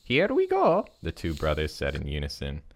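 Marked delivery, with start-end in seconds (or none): 0.0-0.9 Mario catchphrase voice. Acted. Brothers' catchphrase in story.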